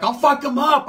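loud, high threat; aggressive threat. Retold or imitated. Changa threatening the women outside, loud high threat. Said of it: "Changa's like".

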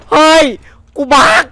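high, loud scared shout; startled yell. Real, not acted. Streamer yelling when the game catches him off guard, startled. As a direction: yelp out in sudden fright.

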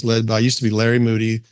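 ordinary delivery

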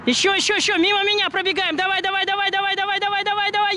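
high, urgent trackside yelling; cheering shout. Real, not acted. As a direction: keep calling her home all the way in. Coach cheering runner final metres.

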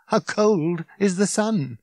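incredulous, stretched-out comeback; hollow irony. A narrator's reading. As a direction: draw it out in bleak disbelief. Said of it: "He gave a hollow laugh".

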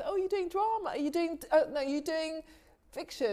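lightly voiced snooty classmate; a hint of a condescending film student; quizzing film-school guy. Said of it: "And he goes".

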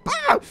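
sudden high scream; frustrated shriek. Pretend. Buyer convinced they scammed him, frustrated shriek. Frustrated over supposed scam.

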